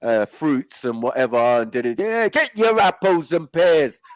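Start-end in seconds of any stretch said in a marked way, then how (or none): 2.0-4.0 high, loud, slow stallholder call; drawn-out mock barrow-boy shout; market trader's cry. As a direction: hawk fruit across a busy market. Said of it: "market things where the guy's".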